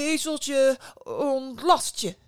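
insistent, ceremonious call; second attempt. A narrator's reading. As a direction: push the incantation harder, drawn out. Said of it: "probeerde het nog een keer".

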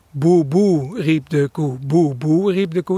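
houtduif coo opening on a double "Boe, boe"; imitated dove mnemonic. Shown as a demonstration. Birder showing how everyone thinks the dove sounds, cooed mnemonic. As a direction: chant "Boe, boe, riep de koe" as a cooing phrase. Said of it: "dan denkt iedereen, ja, zo klinkt het".

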